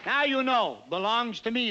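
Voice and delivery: ordinary delivery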